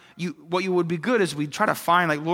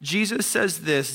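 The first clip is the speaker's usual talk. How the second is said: ordinary delivery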